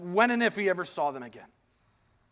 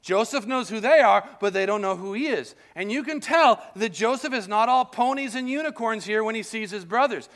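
ordinary talk, nothing marked

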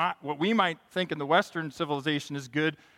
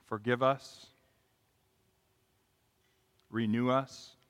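prayer; 4 semitones lower than usual. Real, not acted. Closing prayer.

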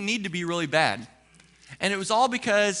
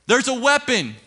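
loud security call-out, pitched up; raised-voice alarm from a screener; loud announcement; 6 semitones higher, 6 dB louder than usual. Retold or imitated. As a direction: call it out loud for all to hear.

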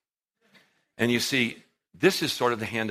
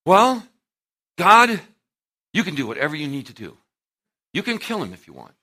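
mock prayer; 4 semitones higher, 8 dB louder than usual. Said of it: "Christians pray prayers like this".

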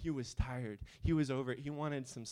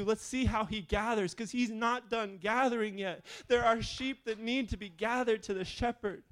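loud, rising fervent preaching; preaching at full force; 4 semitones higher, 8 dB louder than usual. Real, not acted. Pastor stirring the congregation to follow, fervent preaching. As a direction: build the sermon to a roar.

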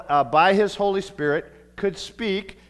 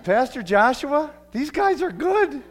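higher, slow voice of a visiting pastor; other pastor voice; 10 semitones higher, 4 dB louder than usual. Retold or imitated. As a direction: voice him a little higher and slower. Voicing another pastor's praise.

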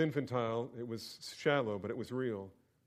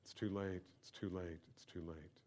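fading quieter repeats of too late; sinking into despair, softer each time; a hushed, hopeless inner voice; despairing thought; 4 semitones lower, 9 dB quieter than usual. Retold or imitated. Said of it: "and I'm thinking".

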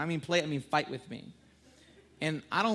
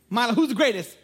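playful call-out; 6 semitones higher, 8 dB louder than usual. Retold or imitated. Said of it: "Mila was a little harder".

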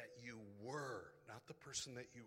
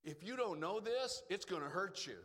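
raised, louder preaching warning; emphatic warning; 5 semitones higher, 8 dB louder than usual. Real, not acted. Preacher warning his flock, loud and pressing. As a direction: hammer home the danger to them.

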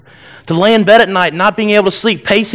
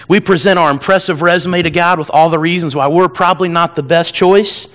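ordinary talk

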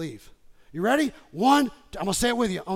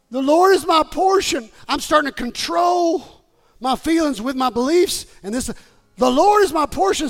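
loud, raised scripture declaration; full-force preaching; 5 semitones higher, 7 dB louder than usual. Real, not acted. Preacher quoting the soul's declaration, forceful raised preaching. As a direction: proclaim it to the whole church.

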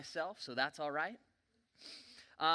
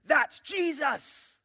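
high, loud, drawn-out shout; big emphatic holler, each word stretched; yelling; 11 semitones higher, 8 dB louder, much slower than usual. Acted. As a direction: yell it slowly at a clueless friend. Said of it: "had to yell at him".

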